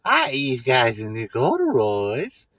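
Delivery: hammed-up come-on to a woman; mock seductive pickup. Comedian courting Sandy, slow hammed-up creep voice.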